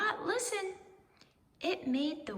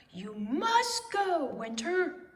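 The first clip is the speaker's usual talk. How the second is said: slow, loud order to go; shouting Owl; 5 semitones higher, 4 dB louder than usual. A narrator's reading. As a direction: Owl shouts Winter out, drawn out. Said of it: "shouted Owl".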